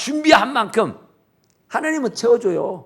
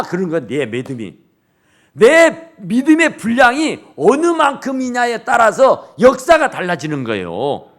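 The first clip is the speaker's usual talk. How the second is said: ordinary talk, nothing marked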